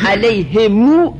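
علیهمو slowly, mim stretched into a long u; lengthened u. Shown as a demonstration. Teacher modelling ishba on the mim, slowly. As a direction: hold the final u of علیهمو. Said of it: "میم مضمومش اشباع میشه".

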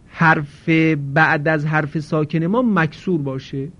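ordinary talk

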